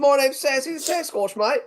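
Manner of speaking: high-pitched mock Aussie line; Australian accent. Shown as a demonstration. Coaching a friend on a silly Australian accent. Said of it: "You say".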